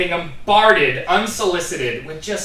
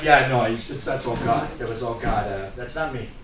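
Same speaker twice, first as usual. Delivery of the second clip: lower, softer modest mumble; humble athlete; 7 semitones lower, 5 dB quieter than usual. Retold or imitated. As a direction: give all the credit away humbly. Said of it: "they're just like".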